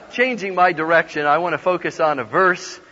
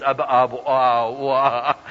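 lower, babbling false start; mock blanking-out stutter; tongue-tied beginning; 4 semitones lower than usual. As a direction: stammer nonsense syllables as if the words are gone. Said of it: "my glowing beginning was".

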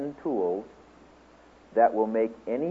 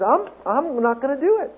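put-on stubborn little girl; 13 semitones higher, 3 dB louder than usual. Little daughter refusing.

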